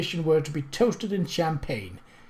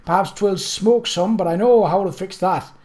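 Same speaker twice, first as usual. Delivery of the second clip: higher and louder, hammed-up delight; melodramatic joy; 6 semitones higher, 5 dB louder than usual. A narrator's reading. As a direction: clasp your heart and gush. Cook's dramatic joy, hands on bosom.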